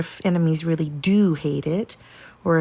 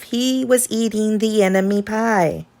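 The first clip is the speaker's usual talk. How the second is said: ordinary delivery, nothing marked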